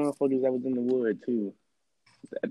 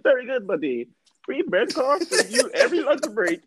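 high mock voice; 11 semitones higher than usual. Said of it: "He said".

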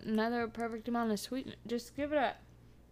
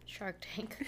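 whisper; 11 dB quieter than usual. Real, not acted. Whispered aside.

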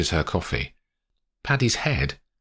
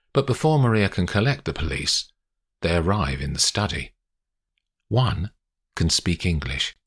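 plain reading voice

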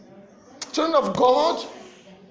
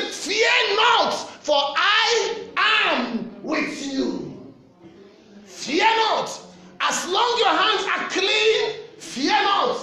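fiery preaching; 5 semitones higher, slower than usual. Acted. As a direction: preach it out with full force. Preaching at full force.

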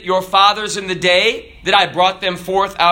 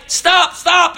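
higher, urgent "stop, stop"; hammed-up plea; mock pleading; 9 semitones higher than usual. Priest begs the runaway car, mock urgent.

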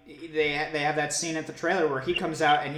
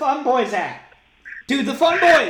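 high, loud whooping call; hammed-up excited holler; mock crowd hype; 10 semitones higher, 8 dB louder than usual. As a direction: shout out to your crew. Hyping an imaginary crowd.

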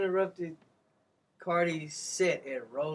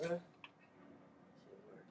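whisper; 19 dB quieter, much slower than usual. Real, not acted. Unvoiced aside.

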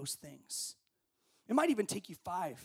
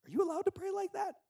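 shocked listener; 3 dB louder than usual. Retold or imitated. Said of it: "you'd be like".

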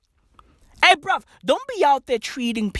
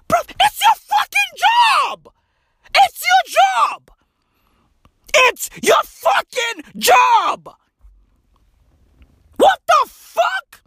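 high, loud, slow yelling; furious shout; 4 dB louder, slower than usual. Acted. Comic yelling at complaining doctors, loud and high. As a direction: bellow it, slowing on each word.

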